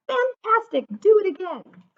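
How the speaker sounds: high, loud cheer; shout. A narrator's reading. Elizabeth urging the tired dragon to fly again, loud cheer. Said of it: "Elizabeth shouted".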